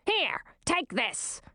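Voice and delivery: high, slow, curt command; curt queen. A narrator's reading. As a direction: push it into her hands. Queen hands over sword.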